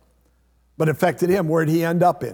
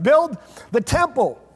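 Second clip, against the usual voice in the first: higher, eager build the temple; mock enthusiasm; 7 semitones higher than usual. Preacher mimicking temple builders, mock eager. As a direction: gush it like a proud builder. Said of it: "Build the church".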